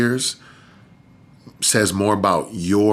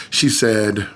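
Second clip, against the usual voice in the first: usual speaking voice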